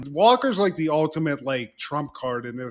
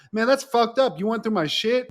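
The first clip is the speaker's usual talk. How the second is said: higher, put-upon protest, imitating the son; mock outrage at mom searching his room; mock indignant teen; 6 semitones higher than usual. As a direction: play the wronged kid who got caught. Teen caught, acts indignant.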